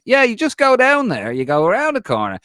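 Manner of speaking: put-on loud, high, thick Dublin accent. Shop worker giving Declan Rice directions in a thick Dublin accent. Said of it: "in the thickest Dublin accent".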